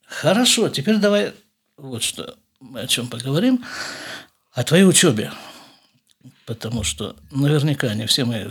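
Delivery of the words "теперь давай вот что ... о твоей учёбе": ordinary delivery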